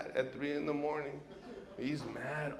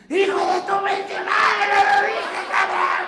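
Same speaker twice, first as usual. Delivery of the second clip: high, loud furious yell in Spanish; father screaming at his son over the payphone; dad screaming; 12 dB louder than usual. Retold or imitated. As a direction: scream it down the phone like an enraged father. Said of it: "My dad can scream".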